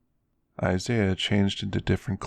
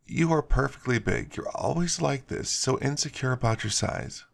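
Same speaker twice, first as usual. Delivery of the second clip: higher, exasperated scoff at him; 5 semitones higher than usual. Acted. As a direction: roll your eyes at his insecurity. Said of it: "it made Heather scoff".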